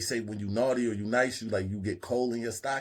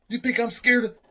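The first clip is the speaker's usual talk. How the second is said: high, loud challenge; defiant yell; 13 semitones higher, 11 dB louder than usual. Retold or imitated. Chip squaring up to the other guy, loud. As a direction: puff up and shout it.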